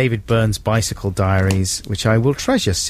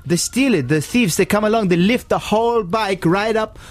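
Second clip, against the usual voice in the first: high, excitable Italian impression; mock Italian accent; 10 semitones higher than usual. Italian cyclist warns about bike thieves, mock Italian accent. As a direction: warn urgently in a hammed-up Italian voice. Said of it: "he was an Italian chap".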